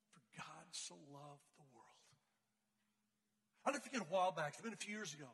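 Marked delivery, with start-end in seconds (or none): 0.1-2.2 slow, low, very soft verse; reverent hush. Real, not acted. Preacher repeating the verse to his listeners, reverently hushed. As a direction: let each word sink in quietly.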